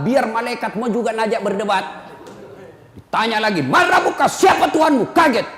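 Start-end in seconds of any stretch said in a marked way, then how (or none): 3.6-5.1 much higher, loud stern demand; grave angel questioning the deceased; angel shouting question. Acted. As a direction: boom the question at the dead man. Angel asks a second time.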